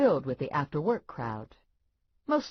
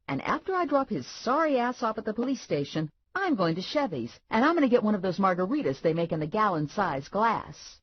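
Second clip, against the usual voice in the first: usual speaking voice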